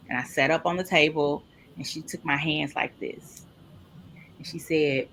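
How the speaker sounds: ordinary delivery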